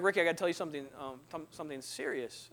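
low, soft imitation of the girlfriend; hushed and halting, acted out; hesitant confession. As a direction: falter quietly into serious news. Girlfriend nervously breaking serious news.

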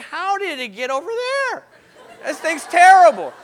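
acted-out bewildered exclamation; incredulous outburst. His astonished reply to the crowd.

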